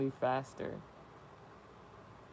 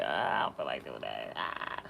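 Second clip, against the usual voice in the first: low, drawn-out lazy whine; lazy tourist; 3 dB quieter than usual. Retold or imitated. Mocking lazy tourists, low lazy groan. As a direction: sigh it out like you can't be bothered. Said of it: "that reputation of".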